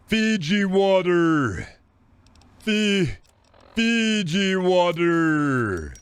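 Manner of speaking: picky guy in the desert. Retold or imitated. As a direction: stretch out every syllable like a snob ordering water. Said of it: "and says".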